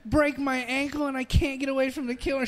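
high, girly mock panic; mock scared girl. Retelling a movie scene, high mock-panicked victim voice. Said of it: "She's like".

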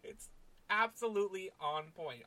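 normal talking voice

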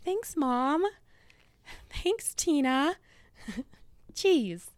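high, laughing sarcastic thanks; sing-song thanks to a teasing parent; amused, high-pitched mock thanks; mock gratitude. Acted reply to teasing mother.

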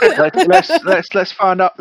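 high, loud, breaking into laughter; laughing through it. Real, not acted. Player steering the game while laughing. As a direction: fight the giggles to get back on track. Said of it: "let's find out about our new manor".

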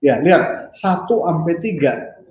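normal talking voice

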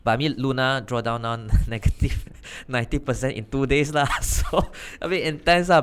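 loud, laughing disbelief. Real, not acted. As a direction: laugh it out, incredulous. Laughing at the Luna crash.

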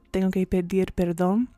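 ordinary talk

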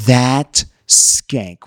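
low, drawn-out disgust; acted scandalized friend, voice dropped; mock outrage. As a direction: spit out the insult in hushed indignation. Acted friend's indignation on caller's behalf.

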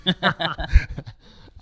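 loud, high burst of laughter that falls away; real laugh. Real, not acted. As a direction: laugh out loud at your own story. Laughing at his own story.

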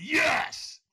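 high, loud yell of yes; screamed yes. Retold or imitated. Answering the trainer's demand for stronger, screamed yes. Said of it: "I screamed".

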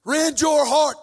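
slow, high, loud shout; shouted command. Acted. Preacher commanding the church to rend hearts, slow shout. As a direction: hammer it out word by word.

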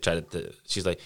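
normal talking voice

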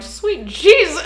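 high, loud mock swoon; swooning outburst. Friend voices a viewer overcome by the actor, swooning outburst. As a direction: cry it out, overcome with attraction.

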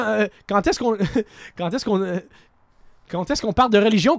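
ordinary talk, nothing marked

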